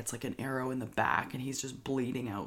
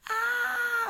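a sudden shriek, much higher; mock scream; 3 dB louder than usual. Narrator imitates family screaming at the arrow, high shriek. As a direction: yelp in fake shock. Everyone reacts to crossbow hit.

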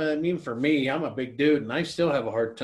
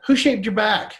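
high, loud teasing jibe; mocking question; 9 semitones higher, 6 dB louder than usual. [name] making fun of John's shaved back, mocking. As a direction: pitch it up and louder to poke fun. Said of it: "[name]'s making fun of him".

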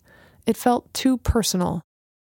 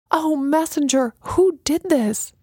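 high, trembling plea; upset and shaken by the wreckage; voice shaking; 7 semitones higher than usual. A narrator's reading. Alana shaken, asking Messenger who wrecked the altar. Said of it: "Her voice shook".

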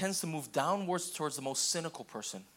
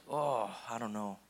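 lower, slow unsure hedge; mock reluctance, a little lower and slower; hesitant; 6 semitones lower, slower than usual. Pastor voicing a hesitant listener, unsure and drawn out. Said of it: "And some of you are like".

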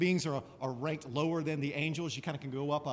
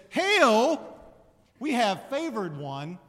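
angel greeting; 4 semitones higher, 8 dB louder, slower than usual. Retold or imitated. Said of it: "so Gabriel says".

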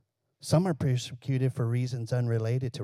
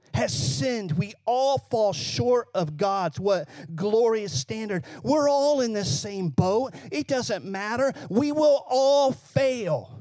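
forceful preaching; 6 semitones higher than usual. Real, not acted. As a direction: preach it emphatically, stretching the final words.

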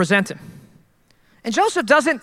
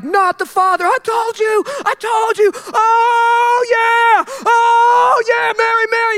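Jerry Springer gloat; 10 semitones higher, slower than usual. Retold or imitated. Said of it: "on Jerry Springer and be like".

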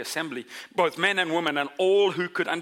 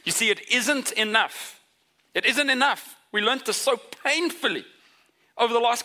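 higher, building insistence on isn't enough; impassioned; 8 semitones higher than usual. Real, not acted. Preacher pressing the congregation that isolation isn't enough, impassioned. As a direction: preach it with rising urgency.

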